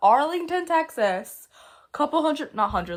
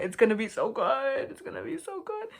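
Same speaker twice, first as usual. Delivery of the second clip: gushing plea; 4 semitones higher than usual. Real, not acted. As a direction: plead excitedly, ending in a small high squeal. Pleading with artist for a movie.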